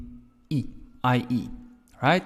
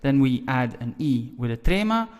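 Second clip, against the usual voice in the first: normal talking voice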